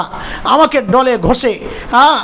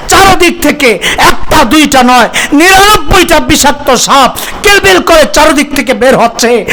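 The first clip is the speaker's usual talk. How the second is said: louder, higher, rising waz preaching; full-force preaching; 3 semitones higher, 6 dB louder than usual. Acted. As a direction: build the grave scene to a fervent climax. Grave scene building to climax. (উচ্চস্বরে আবেগময় ওয়াজ)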